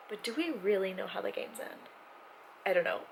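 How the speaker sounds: usual speaking voice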